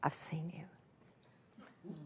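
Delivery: breathy hushed whisper, barely audible; retold hushed whisper from the counter; very quiet whisper. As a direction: whisper it as if sharing a secret. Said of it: "very, very quietly".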